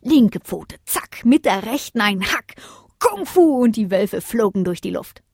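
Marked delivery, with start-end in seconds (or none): none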